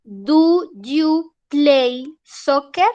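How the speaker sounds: very slow, each word of "Do you play soccer?" stretched out; patient teacher drawing the question out; slow repeat. Shown as a demonstration. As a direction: repeat the question slowly for a student who didn't catch it. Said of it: "¿cómo, profe?".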